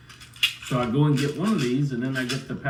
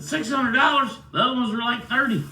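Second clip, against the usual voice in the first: high, loud outraged disbelief at the price; shocked disbelief; 11 semitones higher, 5 dB louder than usual. Retold or imitated. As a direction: echo the price back, stunned.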